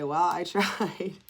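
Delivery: slow, amused shrug of a line through a laugh; laughing "well I tried". Pretend. Crafter laughing at her own failed wreath. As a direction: chuckle it out as if giving up. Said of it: "I can say".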